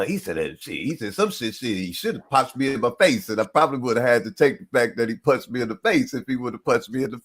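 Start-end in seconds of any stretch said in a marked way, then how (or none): none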